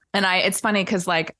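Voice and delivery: ordinary talk, nothing marked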